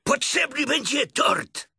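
high, loud, drawn-out tiger voice; excited Tigger. A narrator's reading. Tigger suggesting a cake, high excited call.

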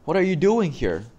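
surprised. Acted. Surprised at seeing professor.

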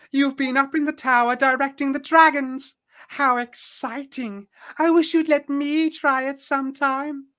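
gushing high put-on voice; high-pitched sorceress. Sorceress begs Tarlene for a turn, high gushing voice. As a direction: squeal with envy, high throughout. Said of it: "you hear a high-pitched voice".

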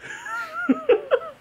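very high squealing giggle; helpless laughter. Real, not acted. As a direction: crack up helplessly. Laughing at friend's story.

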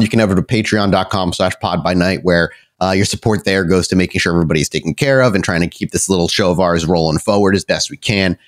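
ordinary talk

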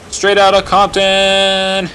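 loud. Pretend. Shouted joke quote.

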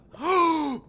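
loud, high, sharp gasp; startled gasp. Real, not acted. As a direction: gasp hard in sudden shock. Startled by a toy appearing in game.

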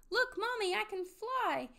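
put-on high, eager child's voice; excited little child. A narrator's reading. Pip-Pip urges Mommy to try flying, excited cry. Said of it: "cried little Pip-Pip".

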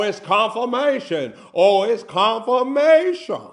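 high, loud, mounting repetitions; full-force preaching. Acted. Preacher on believing God gave the plan, full force.

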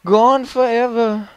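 slow mock-dramatic declaration. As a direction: stretch it out theatrically.

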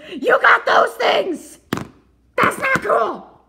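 slow, loud, high protest; outraged shout. Acted. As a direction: cry out indignantly.

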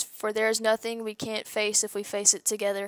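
ordinary talk, nothing marked

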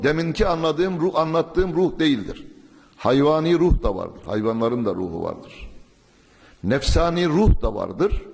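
usual speaking voice

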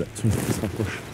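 hushed warning as the seeker nears; whispering while hiding. Real, not acted. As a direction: drop to a tense hush. Seeker is coming closer.

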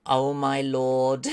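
big gasp, then slow amazement; slow, overwhelmed exclamation; awestruck. Real, not acted. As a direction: draw it out in stunned wonder. Awed by the colour change.

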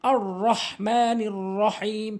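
slow recitation, heavy rolled r in রহমানির রহিম; correct full র. Shown as a demonstration. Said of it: "এভাবে উচ্চারণ করবেন".

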